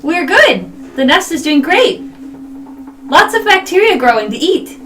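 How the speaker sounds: high, loud happy bug; chirpy insect bragging; cheerful beetle voice. Acted. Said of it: "You hear in beetle speak".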